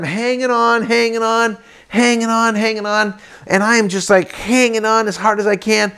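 high, tense repeated phrase; cling for dear life as you speak; acted-out strain, held high; straining to hold on. Clinging to rope behind boat.

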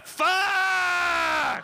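high, loud, drawn-out yell; exasperated scream. Acted. Caller's recorded message ends, exasperated long scream. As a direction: scream it long in mock frustration.

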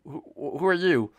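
usual speaking voice

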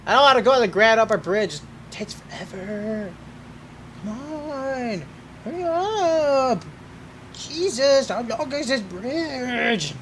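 high, drawn-out whiny groan; impatient whining. Real, not acted. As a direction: whine and stretch the last words impatiently. Whining about long game bridge.

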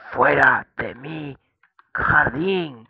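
más alto y agudo, lento, echando a alguien; grito improvisado. Acted. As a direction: espanta a las vacas con un grito de granjero teatral. (grito improvisado y lento de granjero enfadado)

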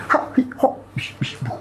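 whispery puffs and whooshes; voiceless mock martial-arts sound effects; playful effort noises. Playful noises while adjusting the body.

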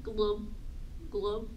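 low, short glubs; low bubbly fish noise. Shown as a demonstration. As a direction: glub like a fish. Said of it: "Can you glub like a fish?".

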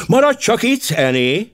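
called out high and loud; calling out. Acted. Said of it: "kiáltotta". (magas, hangos kiáltás)

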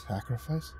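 small, choked, rising question; strangled. A narrator's reading. Man learning he is the sacrifice, strangled. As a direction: choke out the word softly. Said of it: "in a strangled voice".

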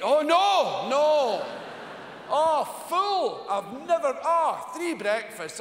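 mock polite refusal. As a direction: overdo the I'm-full excuse. Guests refusing the host's food.

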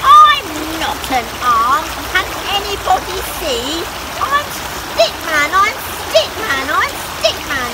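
loud, high insistence; desperate Stickman. Acted.

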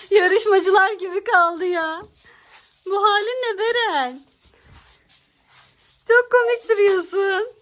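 high, slow amused teasing. Real, not acted. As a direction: tease her playfully.

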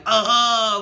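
loud, high pained moans; imitated moaning. Copying the fallen lady's moans, loud mock groaning. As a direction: groan like a hurt woman on the ground. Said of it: "she's moaning like".

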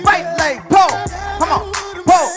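high, loud, pumped-up coaching shouts; hyped fitness coach. Acted. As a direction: call out the moves over the music like a workout instructor. Leads a live workout class over music.

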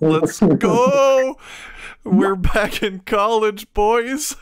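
loud slow party yell; hype shout. Real, not acted.

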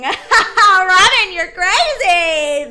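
loud laughing, slow words. Real, not acted. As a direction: laugh it out at her. Laughing at Robin's line.

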